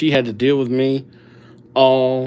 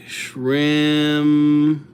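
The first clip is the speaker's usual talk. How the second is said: drawn-out name. Real, not acted. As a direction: stretch out Shrimpo in two long, held syllables. Stretching name while writing it.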